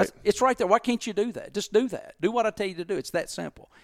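high, insistent retold scolding; exasperated. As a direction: drive each demand home, mock-heated. Re-enacts exasperated rant at staff.

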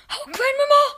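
high, loud desperate cry; boy silently begs grandma to help him; frightened plea. Acted. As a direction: call for grandma in terror. Terrified boy calls for help.

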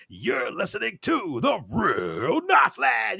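big, high, stretched-out DJ shout; radio jock impression. Host imitating classic jocks opening a show, hyped announcer. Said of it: "the great jocks from back in the sixties".

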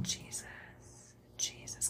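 breathless whisper of a name; faint whisper. Retold or imitated. Retelling her lowest moment, praying in a whisper. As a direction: barely whisper it, exhausted. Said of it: "I just kept saying".

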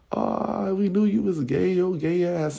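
a hint of a taunting boy; mocking taunt. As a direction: rub it in, lightly voiced. Grandson taunting narrator.

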